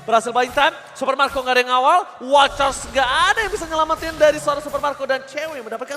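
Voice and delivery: loud and high, slowed on key words; hyped play-by-play. Real, not acted. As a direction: keep the fight call pumped. Caster calling a teamfight.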